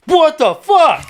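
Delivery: high, loud, drawn-out imitated yell; startled shout. Kid spots a stranger above his ledge, startled yell. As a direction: shout it like someone caught completely off guard.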